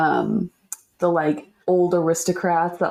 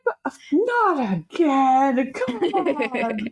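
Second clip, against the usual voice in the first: high, slow whiny plea; pleading victims; 10 semitones higher, slower than usual. Retold or imitated. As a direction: beg in a high, pitiful voice. Forgotten Men pleading, acted.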